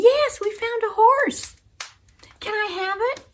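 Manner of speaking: put-on very high, eager little girl; high-pitched breathless eagerness; excited child. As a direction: insist joyfully, then beg. Excited child answers doubting father.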